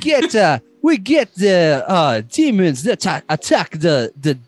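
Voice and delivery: high, sing-song mock Jamaican; hammed-up Jamaican, halting over the words; Jamaican accent. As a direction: plough on with the recap in a badly faked Jamaican accent. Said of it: "Is that Jamaican Mario?".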